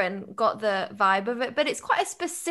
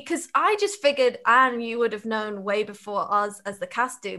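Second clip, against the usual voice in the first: normal talking voice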